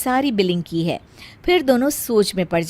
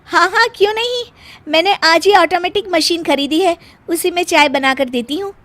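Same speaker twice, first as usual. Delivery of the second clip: much higher, sugary-bright reply; eager bahu; 7 semitones higher, 3 dB louder than usual. Acted. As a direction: answer overly eager and pleased. Meena answers sweetly. (सास को ऊँची मीठी आवाज़ में जवाब)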